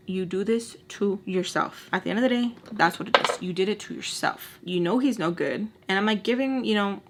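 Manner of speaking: ordinary talk, nothing marked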